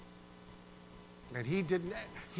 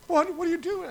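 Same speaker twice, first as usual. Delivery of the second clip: put-on much higher, louder child's outcry; shocked kids; 14 semitones higher, 8 dB louder than usual. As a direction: play the startled kids losing their candy. They said.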